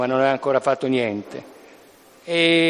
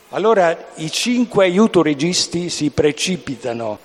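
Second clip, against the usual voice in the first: ordinary delivery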